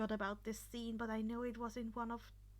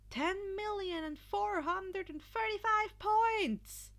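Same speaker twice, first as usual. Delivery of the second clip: announcer voice; 4 semitones higher than usual. Acted. Midnight announcing the leaderboard to the arena, announcer. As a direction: read out results to a crowd. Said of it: "Midnight announced".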